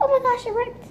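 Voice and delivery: real dismay as the slime tears; shocked gasp. Real, not acted. Slime rips suddenly.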